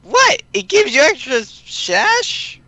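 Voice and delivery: very high, slow Pinkie voice; squeaky bubbly Pinkie voice; high character voice. Acted.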